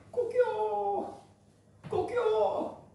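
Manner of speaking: high, slow drawn-out crowing; a drawn-out cock-a-doodle-doo; rooster crow. Retold or imitated. Rooster crows.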